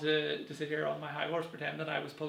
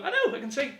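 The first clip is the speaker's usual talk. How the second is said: higher, laughing wife line; mock wife voice; 10 semitones higher, 5 dB louder than usual. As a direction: play the wife, amused. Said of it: "She go".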